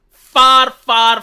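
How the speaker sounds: far, far, pushed out loud; stress on far. Real, not acted. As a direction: hammer each far hard and loud. Emphasising how much better.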